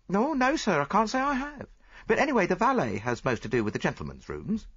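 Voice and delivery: high-pitched servant voice; helpful chambermaid. A narrator's reading. Chambermaid answers the detective about the card, high and helpful. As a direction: answer high and deferentially as a maid.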